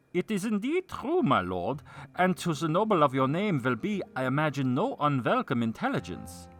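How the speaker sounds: high theatrical vassal voice; loud, stagey courtier; hammy stage actor. A narrator's reading. Vassal voiced in narrated tale.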